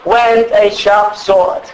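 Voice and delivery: slow, weighty scripture reading; solemn scripture reading. Real, not acted. Preacher reading Revelation to the congregation, slow and solemn. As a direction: let each word of the verse land slowly. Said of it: "It says".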